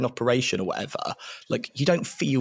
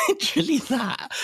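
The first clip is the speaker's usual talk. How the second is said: amused. Real, not acted. After showing the breathing exercise.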